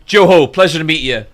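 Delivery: loud, booming hello; hearty greeting. Retold or imitated. Husband greeting the guest with hand out, loud and hearty. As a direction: imitate a big friendly man's welcome.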